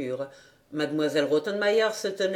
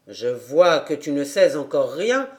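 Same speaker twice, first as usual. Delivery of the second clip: amused gentleman; 6 semitones lower, 3 dB louder than usual. A narrator's reading. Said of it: "fit-il en riant".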